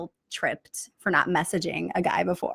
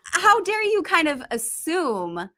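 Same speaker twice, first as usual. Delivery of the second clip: higher, drawn-out mock affront; mild retold indignation; 8 semitones higher than usual. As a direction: act out being mildly offended, pitched up. Acting out indignant reply to messager.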